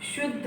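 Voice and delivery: शुद्ध with a light half द and a full ध; careful शुद्ध. Shown as a demonstration. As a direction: clear demo of शुद्ध. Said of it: "द आधा बोल रही हूँ".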